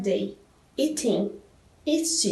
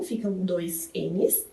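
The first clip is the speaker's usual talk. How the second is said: ordinary delivery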